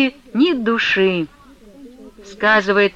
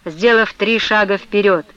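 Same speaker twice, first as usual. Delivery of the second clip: ordinary delivery, nothing marked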